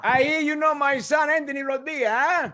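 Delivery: high, loud mock call to strangers; imitating dad calling between cars; embarrassing father. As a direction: holler over to them as an overfriendly dad. Father calling to girls in car.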